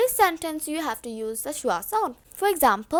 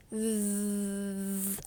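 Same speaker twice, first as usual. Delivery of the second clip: low, sustained buzzing "zzz"; long, quiet vocal buzz; voiced TH buzz; 7 semitones lower, 14 dB quieter than usual. Shown as a demonstration. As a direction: hold a soft vibrating hum on the tongue. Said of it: "Voiced T H has vibration".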